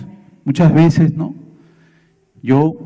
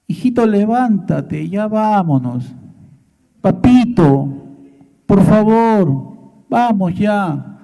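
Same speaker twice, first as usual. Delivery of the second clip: higher-pitched mock coaxing of a child; lightly acted mum, drawing out her plea; imitated pleading mother; 4 semitones higher, 3 dB louder than usual. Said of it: "la mamá lo mira y le dice".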